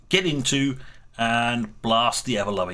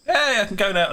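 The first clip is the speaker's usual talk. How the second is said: perky; 9 semitones higher, 4 dB louder, faster than usual. Retold or imitated. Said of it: "looks around and goes".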